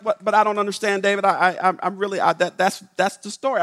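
imitating a baffled man; flustered protest. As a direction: stumble defensively over your words. Flustered Fred retold.

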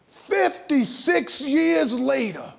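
fifty-six years later stretched slow and forceful; slow emphatic outcry. Real, not acted. Telling the audience how long schools failed, slow emphatic outcry. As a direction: land each word slowly with outrage.

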